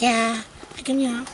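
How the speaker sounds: fast, lightly put-on girly voice; girl voice. Vlogger imitating heroine going for checkup, light girl voice. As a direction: rattle it off in a breezy girl voice. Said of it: "that's my girl voice".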